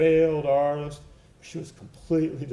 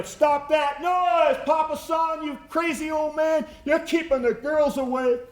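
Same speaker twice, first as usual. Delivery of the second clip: high, louder shout down the stairs; 13 semitones higher, 4 dB louder than usual. Retold or imitated. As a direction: yell at him to stop, voice pitched high. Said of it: "she would shout down".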